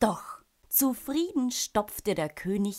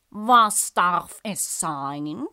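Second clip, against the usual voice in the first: drawn-out, louder servant call; 6 dB louder, much slower than usual. A narrator's reading. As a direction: call out slowly to your master. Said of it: "riefen die Diener".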